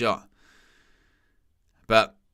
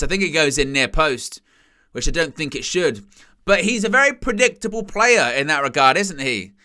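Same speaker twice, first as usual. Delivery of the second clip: usual speaking voice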